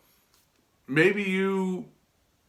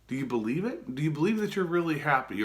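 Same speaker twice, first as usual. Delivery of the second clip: ordinary talk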